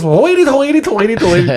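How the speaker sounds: high, hammed-up Irish leprechaun babble; mock leprechaun Irish. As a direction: play up a silly Irish leprechaun lilt. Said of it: "She turns into a fucking leprechaun".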